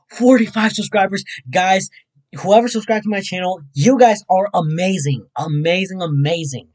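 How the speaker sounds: loud, high, gushing praise; excited thanks. Real, not acted. Streamer thanking whoever subscribed, excited loud praise. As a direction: shout your thanks with joy.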